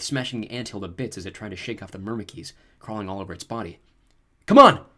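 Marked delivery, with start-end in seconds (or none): 4.5-4.8 loud urgent shout, pitched up high; sharp commanding cry; yelled. A narrator's reading. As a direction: yell it to rally your friends. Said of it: "I yelled".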